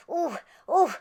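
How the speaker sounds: usual speaking voice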